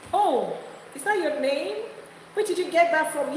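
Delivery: skeptical questioner. Acted. Acted dialogue with skeptical person.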